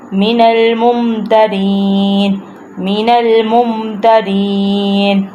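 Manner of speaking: slow, measured tajweed recitation; Quran recitation. Shown as a demonstration. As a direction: recite word by word, drawing out the long vowels. Teacher recites verse word by word. (ٹھہر ٹھہر کر تجوید سے تلاوت)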